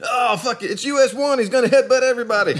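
high, loud frantic cry; panicked bystander. Retold or imitated. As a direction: yelp it like a scared onlooker.

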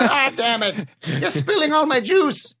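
high, slow whiny villain voice; hammed-up Bane whine. Bane whining as his juice spills out.

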